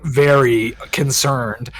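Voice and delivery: ordinary talk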